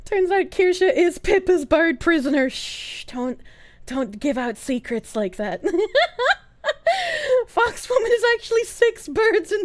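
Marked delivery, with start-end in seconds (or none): 2.5-5.6 mock hush. Hushing a leaked secret.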